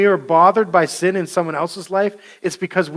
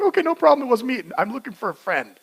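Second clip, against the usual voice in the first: high, nervous mock excuse; sheepish cover-up; 6 semitones higher, faster than usual. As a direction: fake an innocent excuse. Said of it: "It's like".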